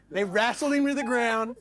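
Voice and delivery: ordinary delivery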